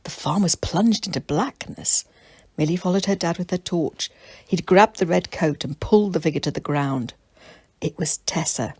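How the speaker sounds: ordinary delivery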